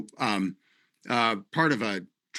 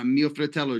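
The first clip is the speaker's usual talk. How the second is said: ordinary talk, nothing marked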